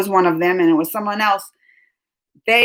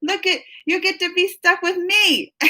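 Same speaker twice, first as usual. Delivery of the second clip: playful teasing; 10 semitones higher than usual. Retold or imitated. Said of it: "I'm like".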